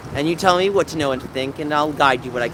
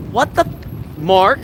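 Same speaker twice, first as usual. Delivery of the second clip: incredulous, pitched up; acted disbelief; 3 semitones higher, much slower than usual. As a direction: blurt it in mock bewilderment. Acts out jaw-dropped reaction.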